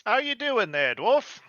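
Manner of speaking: high, playful jab at the dwarf; teasing greeting. Acted. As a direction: josh the dwarf lightly. Player voices character greeting.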